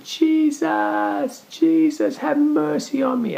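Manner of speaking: very high, straining cry for help; a blind beggar crying out desperately over a crowd; desperate yell. Retold or imitated. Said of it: "desperately just starts yelling".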